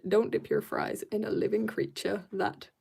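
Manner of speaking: ordinary delivery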